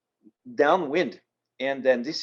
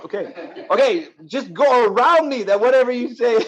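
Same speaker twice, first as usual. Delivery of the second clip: raised, louder, through laughter; laughing retold reply; 10 semitones higher, 7 dB louder than usual. Kayaker gives up on the radio call, laughing. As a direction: give in with a laugh in the voice.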